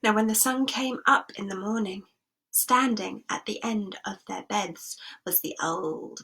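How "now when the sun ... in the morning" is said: plain reading voice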